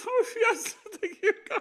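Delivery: high, loud laugh of relief; relieved laughing. Retold or imitated. Freed patient laughing high and loud with relief. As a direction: laugh out the thanks like a weight just lifted. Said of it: "And I'm like".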